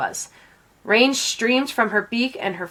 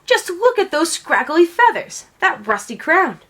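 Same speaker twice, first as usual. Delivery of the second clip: higher, sniffy voice of a scornful queen; disdainful queen; 7 semitones higher than usual. A narrator's reading. Queen scorns the bedraggled guest to the king, sniffy and higher. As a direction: look down your beak at the guest. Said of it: "she said to the king".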